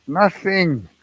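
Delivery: loud, high, exasperated "Nothing"; snapping at an idiot question. Retold or imitated. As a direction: bark it out, fed up. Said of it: "Raised my voice".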